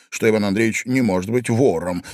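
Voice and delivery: ordinary delivery, nothing marked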